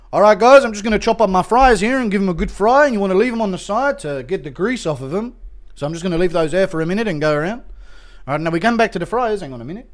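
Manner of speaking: lightly voiced cooking-show host, starting loud; Volk. Cooking host talking viewers through fries, lightly imitated. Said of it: "where Volk goes".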